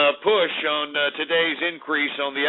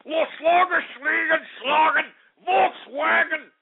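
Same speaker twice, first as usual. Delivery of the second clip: high, slow shouted fake German; hammed-up German accent, much higher; mock German; 11 semitones higher, slower than usual. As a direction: bellow made-up German words in a joke voice. Jokey mock German for DAX.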